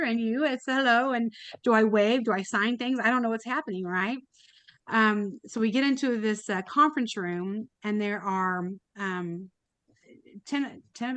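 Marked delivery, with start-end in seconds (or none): none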